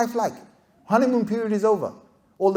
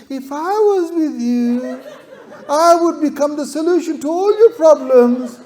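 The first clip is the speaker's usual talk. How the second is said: mock romantic promise; 7 semitones higher, 4 dB louder than usual.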